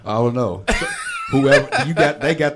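loud laughing through words; cracking up while talking. Real, not acted. Laughing after co-host's joke.

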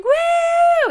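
high, loud joyful whoop; cheering scream. Retold or imitated. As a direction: yell it like a crowd celebrating.

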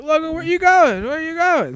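very high whiny calling after; mock drunk call. Imitating the drunk guy calling after Logan, very high.

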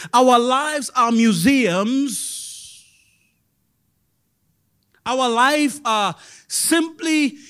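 loud pulpit preaching. Acted. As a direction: drive the sermon home loudly. Preaching at full force.

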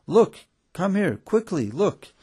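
high, urgent excited call. A narrator's reading. Student summoning friends to the donkey, excitedly. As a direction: call them over, bursting with excitement. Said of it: "the student called out excitedly".

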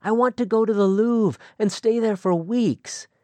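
put-on young woman longing for the world, excited. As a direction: pour out her dreams with growing eagerness. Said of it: "there was excitement in her voice".

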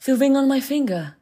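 high, pleading maiden. Acted. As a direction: offer it like a desperate girl. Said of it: "said she".

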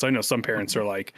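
usual speaking voice

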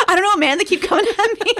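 a dopey hero excusing himself; whiny dude voice; helpless mock Channing Tatum. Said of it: "Channing Tatum's just kinda like".

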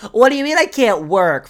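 high, loud mock outrage; exaggerated whine of protest; indignant protest. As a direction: play the aggrieved victim, loudly. Mocks actors playing the victim.

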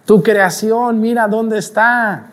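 high, drawn-out gloating devil; taunting devil. Acted. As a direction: rub it in slowly, high and mocking. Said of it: "Para que el diablo ... voltear a Dios y decirle".

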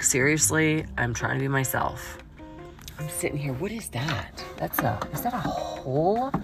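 ordinary talk, nothing marked

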